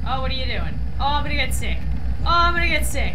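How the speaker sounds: loud, rising cries of dizziness; loud queasy protest. Real, not acted. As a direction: yell as if about to be sick. Spinning in game, dizzy.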